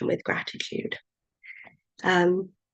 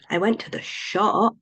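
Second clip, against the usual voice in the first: SHOP emphasised and drawn out; stress on SHOP. Shown as a demonstration. Teacher showing it was nowhere else, stress on shop. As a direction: land deliberately on the final word, shop. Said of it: "nowhere else".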